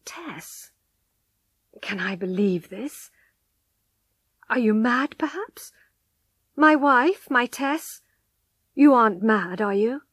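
questioning in stunned disbelief, pitch lifting on key words. A narrator's reading. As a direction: ask as if you cannot believe it. Husband disbelieving wife's confession.

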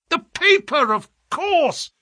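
high-pitched burst of excitement; excited yell. A narrator's reading. Holmes realises what he missed, yelling excitedly. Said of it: "yelled Holmes in a paroxysm of excitement".

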